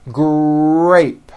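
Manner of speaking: "Grape", short G and long R; strong R blend. Shown as a demonstration. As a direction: draw out the R in "Grape" past the G. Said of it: "hear it more than the G sound".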